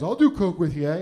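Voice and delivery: hamming up a Canadian football player; Canadian guy. Retold or imitated. Canadian stranger offering to do coke with the comedian, loud Canadian accent. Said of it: "And the guy goes".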